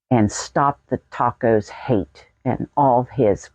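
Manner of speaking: ordinary talk, nothing marked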